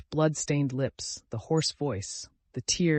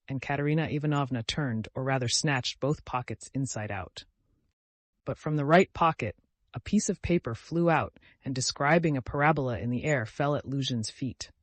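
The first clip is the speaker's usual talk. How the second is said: plain reading voice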